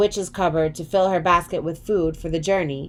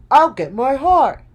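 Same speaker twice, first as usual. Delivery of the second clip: raised, louder voice for the heart; hopeful Woodsman; 7 semitones higher, 6 dB louder than usual. A narrator's reading. Woodsman telling friends his wish, raised and eager. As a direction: dream out loud of a heart. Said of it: "said the Woodsman".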